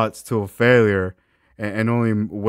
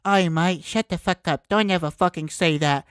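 higher Cockney "Oi, mate" voice, playing friend Chris; mock British accent; 8 semitones higher than usual. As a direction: bark it like a cheeky Brit mate. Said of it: "And Chris was like".

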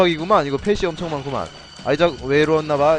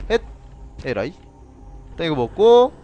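usual speaking voice

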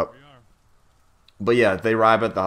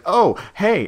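put-on higher, bright-eyed "Oh, hey" in a girlish voice; mock high greeting; 12 semitones higher, 6 dB louder than usual. Naked Nikki greeting the guys, mock high girly voice. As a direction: squeal out a cheery hello. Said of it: "Nikki's like".

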